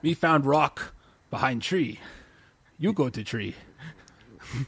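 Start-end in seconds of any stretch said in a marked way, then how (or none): 0.0-3.6 lightly voiced caveman, slow and halting; hint of a slow caveman voice; horrible caveman. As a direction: do a bad caveman, word by slow word.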